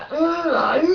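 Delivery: high strained pained squeal; a sudden high yelp of pain; pained high cry. Real, not acted. Telling of the pain, a high yelp.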